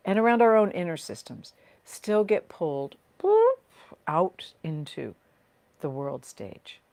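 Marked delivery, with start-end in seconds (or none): 3.2-3.6 high, sudden "boop" sound effect; a playful high-pitched "boop"; popped "boop". Shown as a demonstration. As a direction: make a sudden high boop of being pulled away. Mimes being pulled outward.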